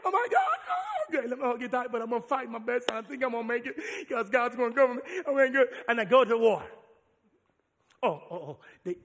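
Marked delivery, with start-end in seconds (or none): 0.1-5.7 high squeaky mock panic; hammed-up panicked dad. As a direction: ham up a frightened father. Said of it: "dad's panicked reply in scene".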